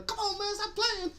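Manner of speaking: high pleading holler with a laugh; hammed-up, squealed imitation; Tracy. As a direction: beg comically through the door. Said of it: "Tracy just banging on the door".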